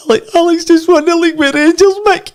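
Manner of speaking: very high, excited imitation of a wife; Linda. Wife tells ex-Celtic captain the news, imitated high voice. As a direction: squeal the news in a put-on high voice. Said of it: "And Linda says".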